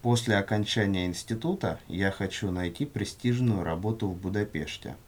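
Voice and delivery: normal talking voice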